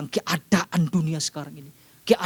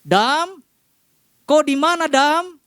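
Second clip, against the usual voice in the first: higher, louder casual call; 10 semitones higher, 5 dB louder, much slower than usual. Shown as a demonstration. Preacher tries God calling Adam loudly. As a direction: shout for Adam across the garden. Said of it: "Nggak tahu seperti apa intonasinya".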